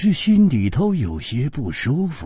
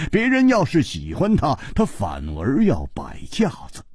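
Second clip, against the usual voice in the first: lower, unhurried, dry teasing; leisurely teasing; 6 semitones lower than usual. Acted. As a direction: tease him lazily, trailing off to a whisper. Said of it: "季冰燕悠悠地说". (悠悠地慢声调侃)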